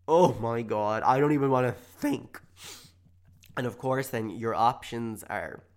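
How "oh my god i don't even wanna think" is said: loud, dramatic dread at a near miss; mock horror. Podcaster reliving a near miss, mock horror. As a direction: exclaim it loudly, aghast. Imagined horror at pressing send.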